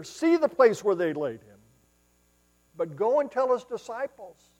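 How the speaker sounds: preacher proclaiming the angel's message; preached scripture reading. Acted. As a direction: proclaim the angel's words to the room.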